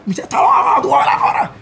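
high furious yell; angry shout. Retold or imitated. Friend hears what happened and shouts furiously. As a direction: yell it like you're ready to fight.

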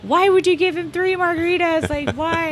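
high-pitched exasperated demand, retold; mock outrage, voice shooting up; upset dad. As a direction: imitate an upset boss demanding to know why. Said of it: "he was so upset".

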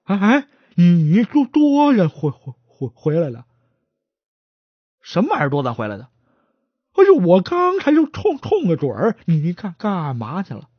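stammering, tripping over every word; a nervous stutterer begging to lead the way. Acted. 结巴的伙计结结巴巴地求着带路. Stutterer startled, calls partner.

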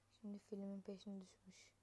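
whispered aside to himself; whispering. Real, not acted. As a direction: breathe it out, unvoiced. Muttering to self mid-game.

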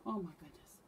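usual speaking voice

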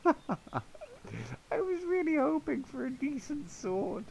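high fake-crying whine; mock whine. Gamer mourning a bad sword, hammed-up whine. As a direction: whimper in mock disappointment. Disappointed whine over loot.

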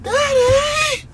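imitating crying toddler. Dad imitating his toddler crying out Daddy, shrill. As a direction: mimic a grumpy toddler yelling. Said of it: "She's like".

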